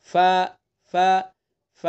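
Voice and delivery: teacher shows a gently breathed fa; fa letter demo. Shown as a demonstration. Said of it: "اس طرح سے".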